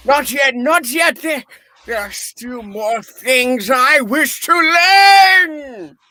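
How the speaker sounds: rising to a loud, crying plea; desperate pleading. Acted. Scrooge begging the fading ghost to stay, loud crying plea. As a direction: beg the spirit not to leave yet.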